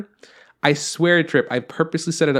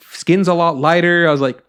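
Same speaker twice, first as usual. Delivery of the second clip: ordinary talk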